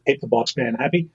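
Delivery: usual speaking voice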